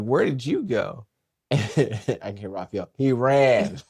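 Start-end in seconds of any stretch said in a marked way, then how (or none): 0.0-1.1 imitating puzzled parents. Parents question the runaway, retold. 3.0-3.8 loud, higher-pitched brother's call; retold tattling shout. Brother tells on the runaway.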